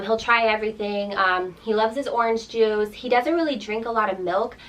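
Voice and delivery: normal talking voice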